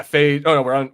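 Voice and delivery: ordinary delivery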